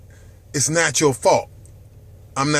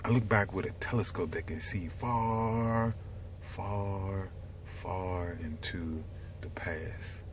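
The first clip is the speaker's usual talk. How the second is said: whisper; 9 semitones lower, 16 dB quieter than usual. Real, not acted. Host musing to viewers about the past, whispered. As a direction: breathe it out like a secret.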